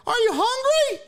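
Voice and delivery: high, blaring holler; loud aunt. Retold or imitated. Aunt asks niece in the car, loud high holler. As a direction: imitate a loud aunt hooting the question. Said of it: "That's how she talked. She was just loud".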